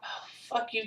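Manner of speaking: under-breath curse; hissed annoyed aside; muttered curse. Real, not acted. Attacked by bird.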